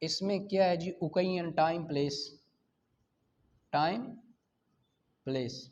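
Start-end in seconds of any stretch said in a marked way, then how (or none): none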